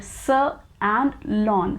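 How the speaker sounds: "sa" and "lon" said slowly and apart; split syllables. Shown as a demonstration. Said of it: "There are two syllables in this word".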